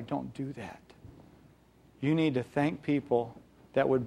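hushed loving admonition; soft fatherly plea. Acted. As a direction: plead gently like a father. God voiced as a father.

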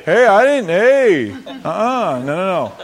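loud, high mock protest of a reluctant father; a father loudly backing out, hammed up. Preacher acting a father dodging, loud mock protest.

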